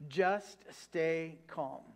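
Just stay calm drawn out very slowly; slow, soothing scripture line. Shown as a demonstration. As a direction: let each word settle like a promise. Preacher repeating the verse.

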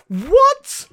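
sudden high incredulous cry; incredulous outburst. Real, not acted. Reacting to Leonard leaving.

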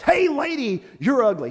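high, loud squawky parrot voice; squawking parrot. Acted. Pet shop parrot squawking insults at passing lady. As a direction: screech it out like a rude parrot.

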